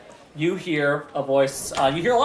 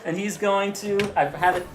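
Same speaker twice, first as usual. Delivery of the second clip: normal talking voice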